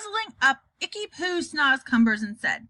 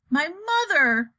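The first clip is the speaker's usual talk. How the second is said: slow, loud indignant cry; 6 semitones higher, 4 dB louder, slower than usual. Acted. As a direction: burst out in disbelief. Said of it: "cried the B F G".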